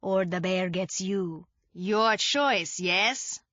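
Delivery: lightly voiced French-Canadian drunk father; a hint of a French-Canadian accent. A narrator's reading. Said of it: "in his French-Canadian accent".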